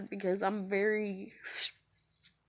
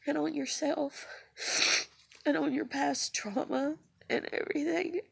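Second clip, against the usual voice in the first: high, teary voice that catches and breaks; trying to explain through tears; real tearful talk; 6 semitones higher than usual. Real, not acted. Explaining her past trauma to viewers while still crying.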